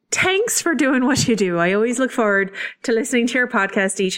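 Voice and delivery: amateur put-on Irish brogue; playful, badly faked Irish accent. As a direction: read listener mail in a mock Irish accent. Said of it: "still have to do the accent".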